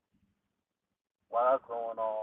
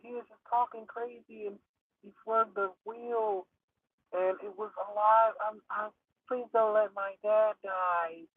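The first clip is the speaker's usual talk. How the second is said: pleading daughter; 10 semitones higher than usual. Retold or imitated. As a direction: explain the crash in a desperate girl's voice. Daughter explaining crash, pleading.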